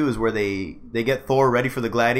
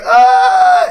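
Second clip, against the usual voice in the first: very high, loud imitated shriek; piercing mock scream; girlish scream; 9 dB louder than usual. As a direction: scream like a girl, mocking. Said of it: "screaming like a girl".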